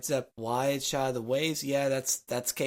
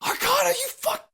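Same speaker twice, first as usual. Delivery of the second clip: angry outburst; 3 dB louder than usual. Real, not acted. Player rages at a failed skill.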